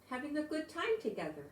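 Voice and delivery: plain reading voice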